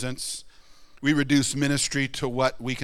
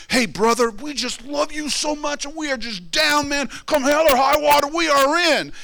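high, loud, gushing mock enthusiasm; gushing church member; 12 semitones higher, 6 dB louder than usual. Preacher mimicking a gushing new church member, high and loud. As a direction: overdo the eager new member's devotion.

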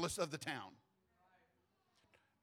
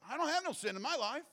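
defiant denial; 11 semitones higher, 4 dB louder than usual. Retold or imitated.

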